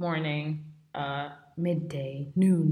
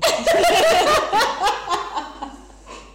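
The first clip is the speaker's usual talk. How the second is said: high laugh; 7 dB louder than usual. Real, not acted. Drunk tearful friends laughing.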